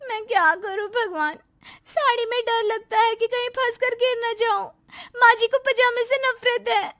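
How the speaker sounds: high, pleading voice close to tears; tearful prayer. Acted. Bride praying alone to god about her clothes, tearful and pleading. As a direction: beg god through tears.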